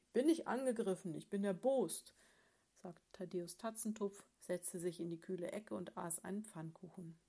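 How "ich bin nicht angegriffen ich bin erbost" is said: loud, indignant grumble; a cross bear of a husband, complaining loudly; the husband bear, snapping at his wife; grumpy bear. Acted.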